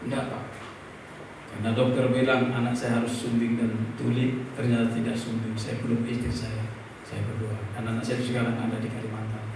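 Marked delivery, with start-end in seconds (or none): none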